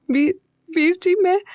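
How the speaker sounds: high, broken words through sobs; sobbing, stammering the first word; crying. A narrator's reading. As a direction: break down while speaking. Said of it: "रोते हुए मीरा बोली".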